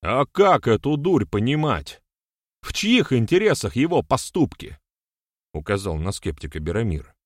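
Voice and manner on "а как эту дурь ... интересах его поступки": loud, high, accusing questions; indignant questioning. A narrator's reading. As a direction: demand answers with voice raised. (возмущённо, громко обличая)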